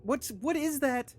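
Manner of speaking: high, loud panicked plea; frantic, calling for help; panicked cry for help. Acted. Friend seems to be dying.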